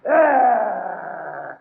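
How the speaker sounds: a sharp pained yelp; pained cry. Acted. Hit in the struggle, a sharp pained "Ah!". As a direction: cry out as the blow lands. Struck during the shootout.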